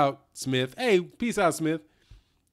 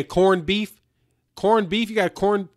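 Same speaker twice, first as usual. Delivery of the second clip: playful, hammed-up Irish accent, higher pitched; slow and higher, a joking Irish lilt on corned beef; mock Irish accent; 6 semitones higher, 4 dB louder than usual. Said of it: "I'm not gonna keep doing the Irish shit".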